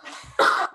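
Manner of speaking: a real dry cough. Real, not acted. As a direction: clear the throat with a cough. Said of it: "I'm gonna cough".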